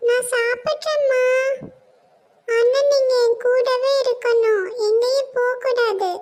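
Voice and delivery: put-on teary little kid begging mom to stay; little child. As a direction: plead like a small sick child afraid of injections. Sick child pleads with mother.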